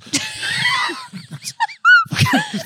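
very high shrieking laugh. Real, not acted. Cracking up at the Nespresso piss joke, shrieking laugh.

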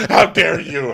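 loud, high scandalized imitation; gasp-worthy affront, hammed up; mock outrage. Everyone's outrage at the swearing.

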